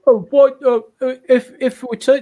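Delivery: high, excited sputtering disbelief; incredulous outburst. Real, not acted. Sudden mock incredulous outburst.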